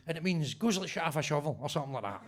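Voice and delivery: ordinary talk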